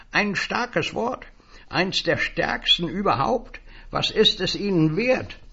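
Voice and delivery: a high, eager little stranger selling words; high, wheedling salesman voice. Acted. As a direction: pitch it up like a pushy little peddler.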